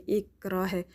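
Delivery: usual speaking voice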